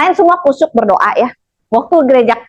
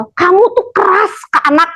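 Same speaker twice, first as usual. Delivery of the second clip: higher put-on voice of a scolding seer; mock fortune teller; 7 semitones higher than usual. The fortune teller scolding Marina before her friends, in a high put-on voice. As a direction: lay down the accusation like a stern seer.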